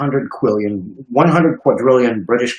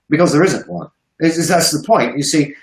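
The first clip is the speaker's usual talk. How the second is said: ordinary talk, nothing marked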